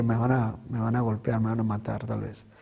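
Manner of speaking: low, hushed thinking to oneself; subdued, trailing inner monologue; inner thought. Retold or imitated. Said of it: "yo pensaba dentro de mí".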